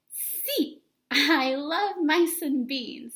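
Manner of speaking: high then loud, slow enthusiastic reply; eager answer. Acted. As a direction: gush about mice and beans.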